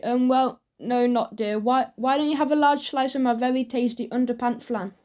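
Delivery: ordinary delivery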